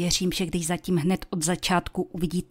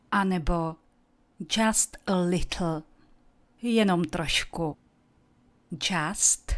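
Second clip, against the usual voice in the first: usual speaking voice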